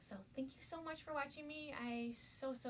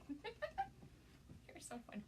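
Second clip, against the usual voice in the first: whisper; 9 semitones higher, 7 dB quieter, much slower than usual. Real, not acted. Laughing whisper aside.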